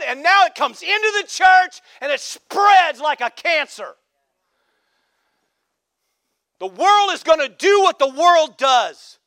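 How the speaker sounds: loud, high, slow preacher's cry; full-force preaching. Acted. Pastor exhorting the church, full preaching shout. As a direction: drive it home from the pulpit.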